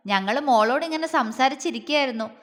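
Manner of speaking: plain reading voice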